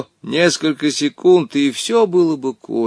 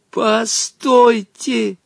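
high, slow desperate call; boy yelling to save his friend; shout with all his might; 11 semitones higher, much slower than usual. Acted. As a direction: cry out to stop the genie. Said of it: "закричал тогда изо всех сил".

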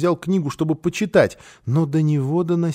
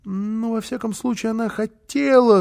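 evasive; 6 semitones higher than usual. A narrator's reading. Boy avoiding a direct answer, evasive. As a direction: dodge the question, pitched up. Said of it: "снова попытался уйти от прямого ответа".

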